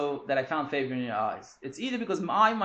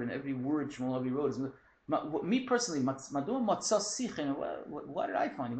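usual speaking voice

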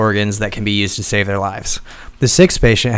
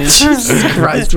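laughing; 12 semitones higher, 3 dB louder, slower than usual. Real, not acted. Embarrassed by teasing.

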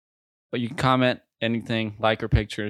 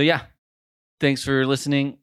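ordinary talk

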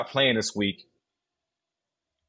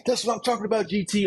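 hyped coach; 10 semitones higher, faster than usual. Retold or imitated. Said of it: "the receiver coach, like".

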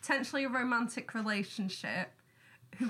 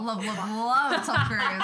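gushing; 7 dB louder, slower than usual. Real, not acted. Gushing about Tom Cruise.

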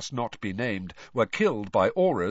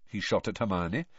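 ordinary delivery

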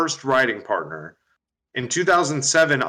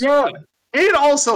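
excited; 13 semitones higher, 5 dB louder than usual. Real, not acted. Jumps in loudly.

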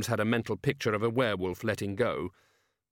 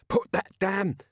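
higher-pitched, cut-off order; urgent alarm; 9 semitones higher, 4 dB louder, slower than usual. A narrator's reading. Vimes horrified by a lit lamp, urgent order. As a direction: break off mid-order in alarm.

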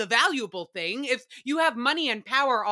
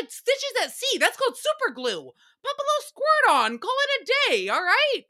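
incredulous rant; 12 semitones higher, 4 dB louder than usual. Real, not acted. Ranting to listeners about the yacht medic, incredulous and high. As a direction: pitch shoots way up, mock-exasperated and louder.

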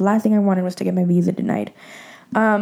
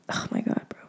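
near-whispered, breath more than voice; hushed aside; 12 dB quieter than usual. Real, not acted. Vlogger recalling dad's tearful words, hushed aside. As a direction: let it drop almost to a whisper. Moved by retold tearful call.

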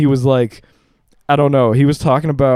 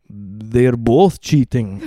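put-on slow, heavy Russian accent; slow Russian-accented dad impression; 3 semitones lower, much slower than usual. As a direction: imitate dad, dragging each word in Russian-accented English. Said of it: "in his Russian accent".

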